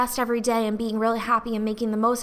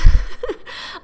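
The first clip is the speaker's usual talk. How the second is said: a sudden high breathy laugh at the irony; teary laugh; 12 semitones higher, 10 dB louder than usual. Real, not acted. Host laughing at herself crying over happiness, teary laugh. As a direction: laugh at yourself through the tears.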